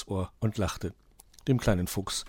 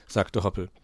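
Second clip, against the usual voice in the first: plain reading voice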